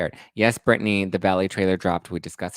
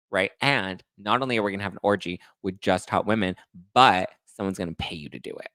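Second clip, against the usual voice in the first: normal talking voice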